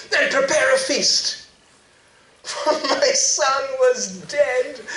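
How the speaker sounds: high, loud, joyful calling out; father shouting to servants to prepare a feast; joyful yell. Acted. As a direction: shout orders across the house in delight. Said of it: "he yelled out to a servant".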